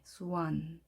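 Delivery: 스완 said softly with an open "ah"; gentle, low-key "swahn"; weakened vowel. Shown as a demonstration. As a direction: soften 스완, easing into the n. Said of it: "약하게".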